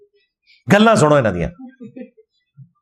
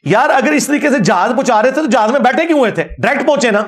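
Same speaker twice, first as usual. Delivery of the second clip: exasperated sarcasm; 7 semitones higher than usual. Real, not acted. Sarcastic rhetorical challenge.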